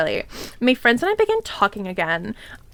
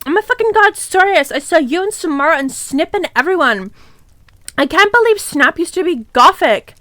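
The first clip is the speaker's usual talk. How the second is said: high, loud gushing gasp; excited shriek; 5 semitones higher, 4 dB louder than usual. Acted. Character thrilled at seeing friends, squealing loud and high. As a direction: gush it out, squealing.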